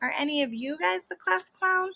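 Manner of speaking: usual speaking voice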